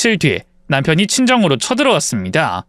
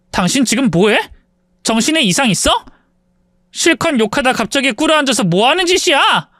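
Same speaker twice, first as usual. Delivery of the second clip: incredulous scolding; 7 semitones higher than usual. Acted. Wife baffled by kneeling husband.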